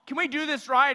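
mock exasperated jab at the bosses; exasperated complaint. Citizen complaining about their rulers, mock exasperated. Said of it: "we look at them and we're like".

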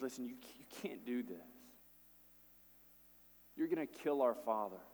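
soft, urgent plea; pleading Judah. Retold or imitated. Judah begging Joseph for Benjamin, soft pleading. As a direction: beg him quietly.

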